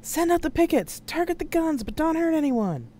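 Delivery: high, urgent shouted orders; yelling into comm. A narrator's reading. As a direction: yell commands over the radio. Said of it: "then yelled into his wrist comm".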